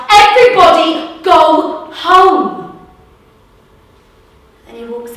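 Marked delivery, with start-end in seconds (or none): 0.1-2.9 commanding call. Acted. Bouncer disperses crowd again.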